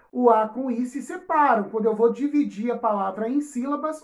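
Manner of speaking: ordinary delivery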